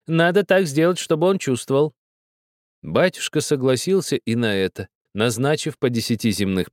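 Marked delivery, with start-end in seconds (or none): 0.1-1.9 put-on high, pressing old woman's voice; insistent old woman. A narrator's reading. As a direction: insist like a stern grandmother.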